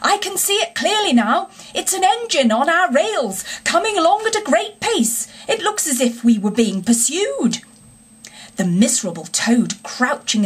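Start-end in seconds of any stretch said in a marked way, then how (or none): 0.0-7.6 high-pitched excited lookout call. A narrator's reading. Engine driver calling back to Toad from the coals, calling out. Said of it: "Presently he called out".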